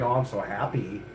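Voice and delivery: sugary mock happy; mock sweet. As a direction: sneer at fake niceness. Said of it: "not fucking".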